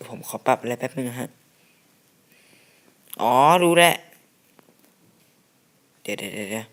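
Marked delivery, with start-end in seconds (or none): 3.2-3.9 loud, high burst of "got it"; excited realization. Real, not acted. Gamer to viewers on fixing the settings, loud excited realization. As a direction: shout out the discovery, much higher and louder.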